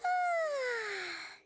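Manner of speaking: long, high falling sigh; contented waking sigh. Acted. Paimon wakes from good sleep, long falling sigh. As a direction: let out a long morning stretch sigh.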